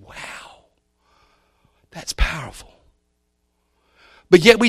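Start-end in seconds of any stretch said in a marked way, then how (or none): 0.0-2.7 whispered, hushed amazement; awed whisper. Retold or imitated. Preacher recalls reading about Satan's accusations, whispered awe. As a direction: breathe it out in quiet awe. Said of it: "I said".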